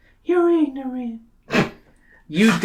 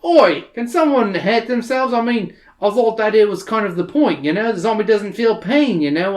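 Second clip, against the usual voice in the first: sarcastic Australian bloke; Australian accent; 6 semitones higher than usual. Acted. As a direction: grumble it in a broad Australian accent. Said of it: "great Australian accent like I can".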